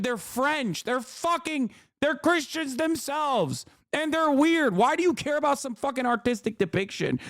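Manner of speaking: high-pitched, incredulous outrage; exasperated rebuttal. Real, not acted. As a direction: argue back in disbelief. Incredulous rant back at objectors.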